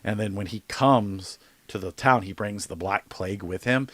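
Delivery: ordinary delivery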